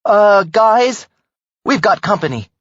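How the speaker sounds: deadpan alarm from the driver's seat; stated flatly. Acted. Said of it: "stated flatly".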